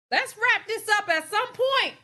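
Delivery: high and loud, pushing to move on; loud wrap-up call. Real, not acted. Host urging viewers to end the topic, loud and high.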